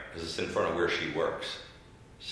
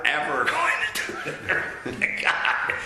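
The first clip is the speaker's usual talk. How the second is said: laughing; 7 semitones higher than usual. Real, not acted. Laughing through a vow.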